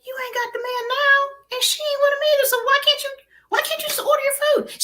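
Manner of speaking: high, sing-song exasperation; exasperated reasoning. Retold or imitated. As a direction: coax her like a stubborn child. Reasoning with rude friend.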